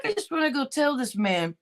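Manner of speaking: ordinary talk, nothing marked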